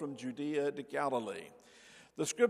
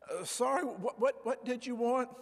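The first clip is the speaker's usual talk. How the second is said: confused clerk; 8 semitones higher than usual. Retold or imitated. Confused drive-thru worker voiced.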